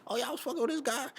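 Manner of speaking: high mocking woman's voice; high mock of a girl gossiping. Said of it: "use in the future on us".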